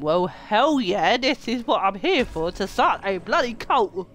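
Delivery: loud and eager; excited. Real, not acted. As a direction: cheer it out, pumped. Eager to start cult.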